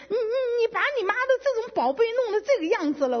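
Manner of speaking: high, fast retold scolding of a child; retold angry scolding. 对儿子翻旧账，模仿自己当年高声急促地骂. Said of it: "我就真的拼命的骂他哈".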